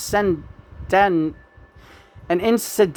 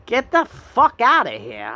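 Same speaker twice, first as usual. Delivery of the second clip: dismissive telling off; 5 semitones higher than usual. Real, not acted. Telling off a critic.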